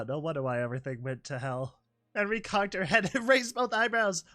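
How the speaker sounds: ordinary talk, nothing marked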